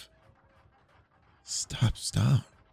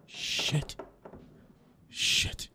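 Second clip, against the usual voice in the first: soft whispered curse; whispering while hiding; 6 dB quieter than usual. Acted. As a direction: breathe it out under your breath. Hiding in closet.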